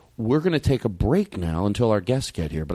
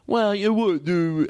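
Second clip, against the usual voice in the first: slow, mock-dim drawl; a lightly voiced dumb guy, slowed right down; 6 semitones higher, much slower than usual. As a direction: drag it out like a clueless person. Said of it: "and I do the dumb voice".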